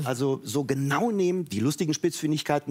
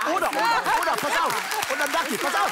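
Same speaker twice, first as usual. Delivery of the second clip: working himself up; 6 semitones higher than usual. Real, not acted. Telling friends about the Stöcker video, getting higher and more worked up. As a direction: build the rant up, half laughing. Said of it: "ich steigere mich".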